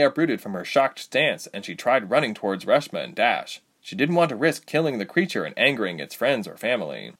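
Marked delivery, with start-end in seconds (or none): none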